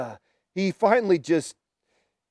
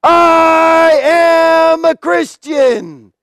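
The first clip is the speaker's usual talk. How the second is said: long drawn-out, high shout; acted martyr's defiant proclamation; bold declaration; 10 semitones higher, 8 dB louder, much slower than usual. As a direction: cry it out to a whole arena, stretching the words. Martyr repeats his cry to emperor.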